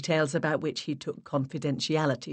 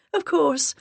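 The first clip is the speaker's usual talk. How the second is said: much higher and louder, eager; high put-on agreement, a bit louder; 11 semitones higher, 4 dB louder than usual. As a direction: voice up an octave, repeating sweetly. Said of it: "I raised my voice an octave".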